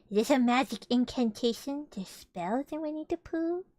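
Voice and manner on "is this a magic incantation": ordinary delivery, nothing marked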